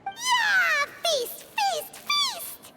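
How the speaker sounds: high-pitched gleeful chant; excited cheering. Acted. Companion chanting for promised feast.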